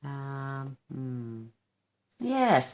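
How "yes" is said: excited yes. Real, not acted. Deciding on the colour.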